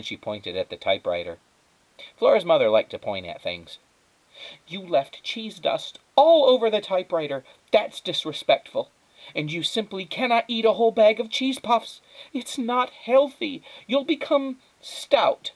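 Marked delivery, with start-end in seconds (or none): 4.7-15.5 high, loud, slowing lecture; stern scolding. Acted. Mother scolding Flora over cheese puffs, stern. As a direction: wag the finger with your voice.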